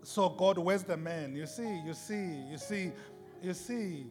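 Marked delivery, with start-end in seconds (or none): none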